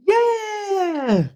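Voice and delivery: drawn-out, very high infant squeal; imitated baby yell. Father shows how baby tried speaking up, high mock yell. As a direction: mimic a baby yelling, not crying. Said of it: "like, her yelling".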